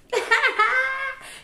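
high-pitched laugh. Real, not acted. Vlogger opening her story with a laugh, high laughing. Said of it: "Let me start by laughing".

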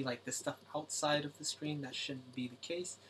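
ordinary talk

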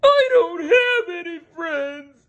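high, loud cartoon wail; Patrick wailing. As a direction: wail it like a homesick kid. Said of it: "Patrick wailed".

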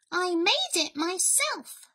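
high little mouse voice; high storybook mouse; helpful mouse. A narrator's reading. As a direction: present it proudly as a tiny mouse. Mouse serves soup.

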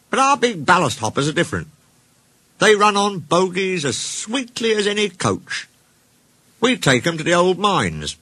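high little-engine character voice; small engine Mike, boasting. Acted.

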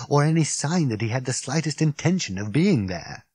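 plain reading voice

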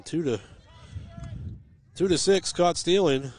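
rising, loud live call of the play; excited play-by-play. Acted. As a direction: call it fast and loud as it happens. Excited call of a caught stealing.

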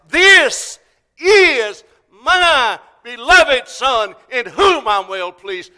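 very loud, high, each word stretched out; God's loudest voice. Acted. God to the entire world at the resurrection, loudest voice. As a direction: thunder it out slowly, word by word. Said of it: "said it with the loudest voice".

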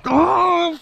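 real surprised shout; startled shout. Real, not acted. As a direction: yelp as the fish breaks the surface. Sees fish surface.

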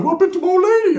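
high, loud imitated outcry; mock upset primo. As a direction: wail it like a distressed cousin.

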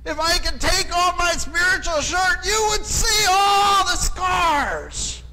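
high, loud, slow pulpit shout; preacher's shout. Retold or imitated. Seminary president baring his scars to students, loud slow shout. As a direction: bellow it slowly like a wounded old preacher. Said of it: "he says".

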